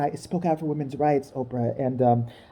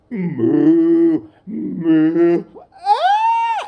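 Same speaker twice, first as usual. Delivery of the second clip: loud mock cow moos ending in a shriek; loud mooing; 6 dB louder, much slower than usual. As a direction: moo at her, then squeal. Mocking Kate with animal noises.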